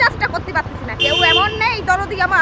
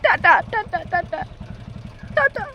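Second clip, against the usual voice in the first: mock sadness; 4 semitones higher, 6 dB quieter than usual. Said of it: "নাটক করিস না".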